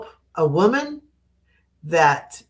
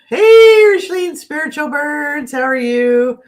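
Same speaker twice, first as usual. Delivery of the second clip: a sudden, much higher and louder hey; excited greeting; 11 semitones higher, 6 dB louder than usual. Real, not acted. Streamer greets a viewer in chat, excited call-out. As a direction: call out to a friend who just arrived.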